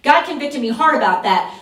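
ordinary talk, nothing marked

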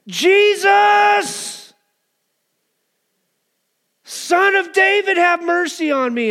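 full-out, high, drawn-out cry. Acted. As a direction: bellow the plea high and long, as a beggar desperate to be heard. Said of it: "He shouted all the more".

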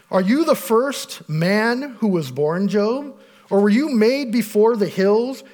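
scripture reading. Acted. Pastor reading Job's accusers to the congregation, declaiming. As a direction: read the accusers' words aloud with weight. Reads accusers' speech in Job.

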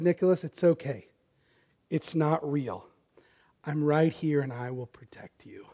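soft, low reassuring words sinking to a whisper; tender reassurance. Retold or imitated. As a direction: soothe a frightened child close to his ear. Father comforting scared son in dark.

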